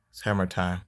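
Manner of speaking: usual speaking voice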